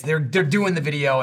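usual speaking voice

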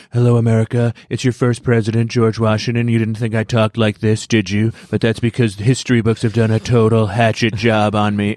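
lightly imitated Trump as George Washington; mock presidential address in a borrowed voice; Donald Trump. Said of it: "Donald Trump voice".

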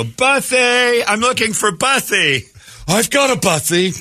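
high, drawn-out call across the park; calling for the dog. Pretend. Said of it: "Just go".

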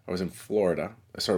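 ordinary talk, nothing marked

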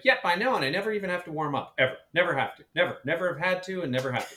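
high, bright boastful put-on voice, quick and breezy; mimicked singer's boast. Colleague imitating a soprano's breezy boast, high and quick. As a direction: breeze through it like a diva who never warms up. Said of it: "She's like".

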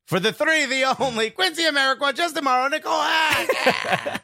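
rising hype announcer, names stretched high; grand entrance announcement. Acted. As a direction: announce them like a boxing MC. Introducing guests like an announcer.

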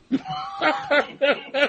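a high, breathy burst of laughter. Real, not acted. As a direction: laugh out at your own story. Laughing after the mommy story.

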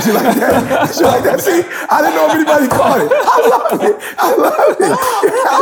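high, laughing lady voice way up in pitch; high-pitched mock lady breaking into laughter.

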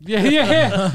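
laughing along, breaking up; laughing yeah. Real, not acted. Cracking up at his friend's story, laughing.

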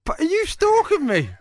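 high, loud disbelieving yelp; incredulous. Real, not acted. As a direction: shriek it in mock alarm. Quiz knows his private life.

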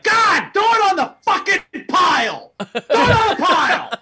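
high, loud acted outburst; hammed-up fed-up yelling; exasperated shout. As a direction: yell like someone at the end of their rope. Acts out exasperation over a chipped plate.

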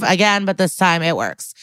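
normal talking voice

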